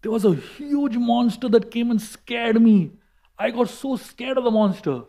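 high, quick acted report of a fright; imitating someone recounting a scare; agitated retelling. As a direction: tell it high, as shaken news to a companion. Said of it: "Balaram told Krishna".

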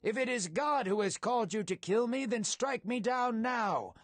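restrained storyteller's bellow across the camp; bellowed appeal. A narrator's reading. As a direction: call out a plea for all to hear. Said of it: "David's words bellowed for all".

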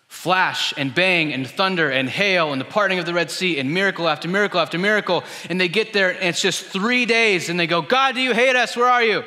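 loud, high, driving sermon build; rising to a shout over miracle after miracle; preaching at full force. Acted. Preacher building to the people's cry, then loud.

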